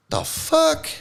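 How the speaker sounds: "The fuck?" squeaked up high, mock-incredulous; squeaky mock disbelief. Repeats it in a squeaky voice.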